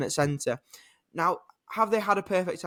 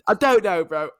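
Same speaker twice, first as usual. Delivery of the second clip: normal talking voice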